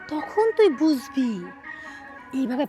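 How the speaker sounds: slow, drawn-out warning; daughter-in-law slowly warning the old woman; slow menace. Acted. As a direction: stretch each word as a threat. Slow threat.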